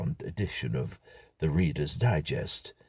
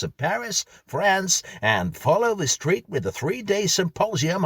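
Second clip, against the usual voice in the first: much higher, louder brassy American hype; brash American MC at full tilt; American announcer; 13 semitones higher, 6 dB louder than usual. Acted. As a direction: oversell it like a gushing game-show host. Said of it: "said the voice from the record player".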